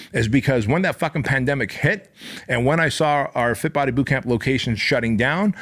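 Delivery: ordinary delivery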